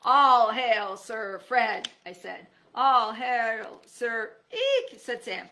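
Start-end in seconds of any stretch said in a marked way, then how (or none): 0.0-1.9 loud, grand proclamation; proclaim. A narrator's reading. Narrator hails Fred as a knight, loud and grand. Hailing the hero. 2.7-5.0 loud, grand proclamation; proclaim. A narrator's reading. Sam hails with a startled twist, loud.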